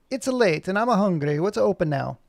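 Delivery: put-on lightly lilting Italian accent; slight Italian accent. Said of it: "says in a slight Italian accent".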